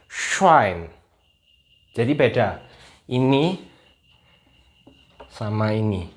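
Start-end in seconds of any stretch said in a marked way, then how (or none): 0.1-0.9 "Schwein" with a long hushing sh, falling pitch; sch sound. Shown as a demonstration. Teacher contrasting SCH for viewers, hushed sh onset. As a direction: open "Schwein" on a full sh. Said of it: "kita pakai S C H".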